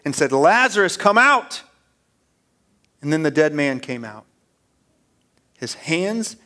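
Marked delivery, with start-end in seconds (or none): 0.3-1.6 raised, commanding shout; loud calling out. Acted. Jesus summons the dead man from the tomb, calling loudly. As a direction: call it out high and loud, as a summons. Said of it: "Jesus called out in a loud voice".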